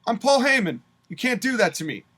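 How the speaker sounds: a hammed-up outraged Heyman; mock Paul Heyman. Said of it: "And Paul Heyman's like".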